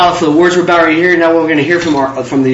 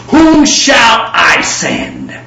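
voice of God; 5 semitones higher, much slower than usual. Retold or imitated. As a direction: draw out "Whom shall I send?" like the creator calling out. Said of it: "That's not how the creator of the universe talks".

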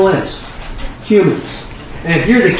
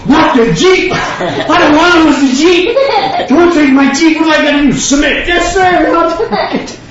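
mock panicked son; 9 semitones higher than usual. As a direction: beg for the Jeep in mock horror, half laughing. Acted panic over losing Jeep.

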